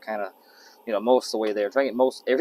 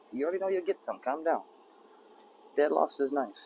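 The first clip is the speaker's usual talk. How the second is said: ordinary talk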